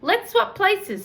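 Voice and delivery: put-on high, slow, gallant old gentleman; an old gentleman offering to swap places with a girl; courtly old gentleman. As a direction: offer it like an old-fashioned gent. Gentleman's formal suggestion.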